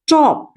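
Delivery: टॉप said high and clear; टॉप with a short open o; model word top. Shown as a demonstration. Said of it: "इसे पढ़ते हैं". (टॉप ऊँचे स्वर में साफ़ उच्चारण)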